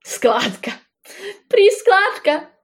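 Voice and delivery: mocking disbelief through laughter, jumping high; amused, incredulous echo of the word; laughing derision. A narrator's reading. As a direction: scoff as a bear tickled by nonsense. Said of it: "Smál se medvěd a plácal se".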